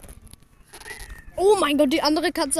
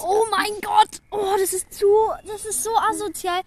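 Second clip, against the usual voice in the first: outraged excitement; 6 semitones higher than usual. Real, not acted. Reacting to a cruel clip.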